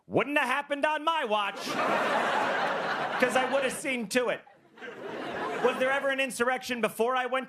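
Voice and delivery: smug mock bravado; mock tough guy. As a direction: brag like a self-appointed guardian.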